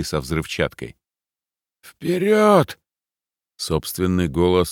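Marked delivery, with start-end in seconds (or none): 1.8-2.8 high, loud shouted charge; battle cry. A narrator's reading. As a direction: yell it to send the squad in. Leader shouts charge after blast.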